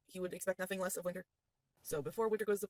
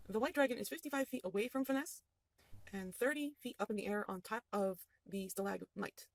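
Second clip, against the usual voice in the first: usual speaking voice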